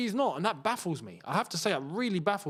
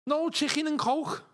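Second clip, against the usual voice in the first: mock Scouse; 8 semitones higher than usual. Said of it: "I could never do Scouse".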